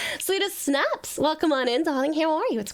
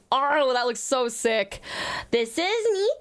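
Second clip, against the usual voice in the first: ordinary talk, nothing marked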